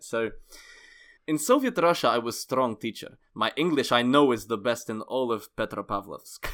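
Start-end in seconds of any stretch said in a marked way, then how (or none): 1.3-6.5 put-on thick Russian accent in broken English. Host reading out Nikolai's review, Russian accent. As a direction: play a proud Russian English teacher. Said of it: "a bit of Russian in it".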